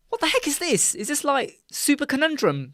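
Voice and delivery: very high squeal of disbelief; mock bewilderment. Viewer sat there going, high-pitched mock bewilderment. Viewer acted baffled by show.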